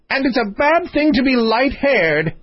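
shrieked comeback, pitched right up; screaming. A narrator's reading. Queen calling after departing king, screaming. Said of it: "screamed she".

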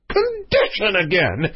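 high, outraged royal exclamation; roaring king. Acted. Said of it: "roared the king".

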